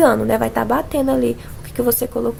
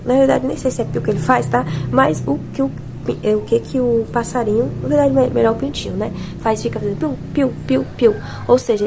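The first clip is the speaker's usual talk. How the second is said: normal talking voice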